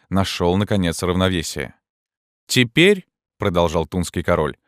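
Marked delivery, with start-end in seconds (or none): 2.5-3.1 sharp high call of now; commanding call. A narrator's reading. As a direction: bark the order upward. Bandit king orders Gringoire.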